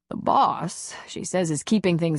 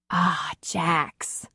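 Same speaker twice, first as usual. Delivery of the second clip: wistful. Acted. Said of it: "Nora said almost wistfully".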